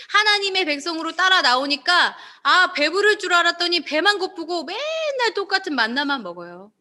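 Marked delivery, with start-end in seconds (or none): none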